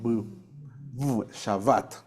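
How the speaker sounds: slow, low demo of V in Shabbat; labiodental V. Shown as a demonstration. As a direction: buzz the V, then Shabbat with that V. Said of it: "Porque esta es de labial".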